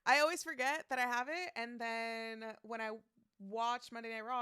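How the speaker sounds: normal talking voice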